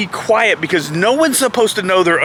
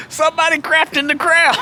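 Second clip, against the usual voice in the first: higher-pitched imitated complaint; mock outrage; 9 semitones higher than usual. Guy in the ring saying it, mock outraged. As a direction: play a wrestler announcing a disgusting discovery. Wrestler in ring noticing stench.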